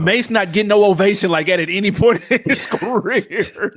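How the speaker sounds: high-pitched, breaking into laughter; real laughter rising through the words; laughing disbelief. Real, not acted. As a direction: scoff at the idea, cracking up by the end. Scoffs laughing at the comparison.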